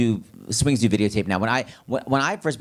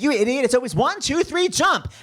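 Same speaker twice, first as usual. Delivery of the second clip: higher, rising comic count; mock impatient call to jump; retold exasperated countdown; 10 semitones higher than usual. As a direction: snap the insult, then count up high. Retold comic bit, impatient character.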